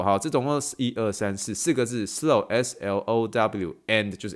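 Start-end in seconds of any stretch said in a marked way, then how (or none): none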